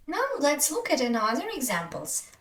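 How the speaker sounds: usual speaking voice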